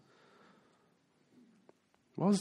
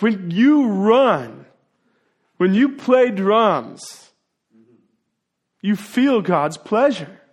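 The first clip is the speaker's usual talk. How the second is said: preaching at full force; 4 semitones higher, 6 dB louder, slower than usual. Acted. Preaching climax.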